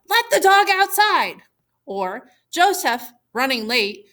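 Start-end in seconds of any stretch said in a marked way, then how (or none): 0.1-1.4 higher and louder, a lightly acted yell; mock hurried yell. Teacher voicing Joseph yelling to Gianna while late, mock yell. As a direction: call it out as if running late. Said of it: "Running late, Joseph yells to Gianna".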